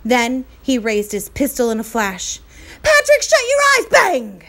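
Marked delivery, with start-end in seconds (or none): 2.8-3.9 much higher and louder sudden yell; urgent shout. Acted. Cowboy warning Patrick before firing, shouting. Cowboy about to fire pistol. 3.9-4.4 a sharp loud spoken bang; loud gunshot bang. Acted. Narrator imitating the cowboy's pistol shot, loud bang.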